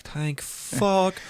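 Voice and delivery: high, long-drawn sigh of relief in words; acted relief. Re-enacting his reaction to passing, acted relief. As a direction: let out exaggerated relief, slowly.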